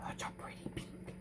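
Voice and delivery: hushed, breathy aside about the pink; soft admiring whisper to the camera. Real, not acted. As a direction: whisper it like a secret delight. Admiring paint colour up close.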